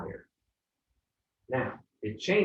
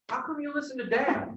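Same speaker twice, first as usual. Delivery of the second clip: put-on much higher, childlike complaining voice; whining kids. Kids asking mom why they obey dad, in a high childish whine. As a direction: squeak it out like a frustrated kid. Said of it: "they're like".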